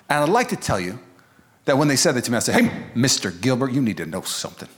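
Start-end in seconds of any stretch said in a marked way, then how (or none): 2.5-4.7 lower, softer, mock-solemn proclamation; a lightly voiced mock sermon to the angry dad; pretend bold preacher. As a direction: pretend you were brave. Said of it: "I didn't do any of that".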